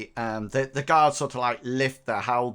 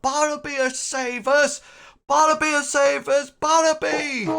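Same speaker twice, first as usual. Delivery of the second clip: very high acted rallying cry; crowd shout; 13 semitones higher, 4 dB louder than usual. Rabble hails Bartleby as saviour, high shouting. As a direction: cheer for a hero in a high voice. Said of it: "shout comes up".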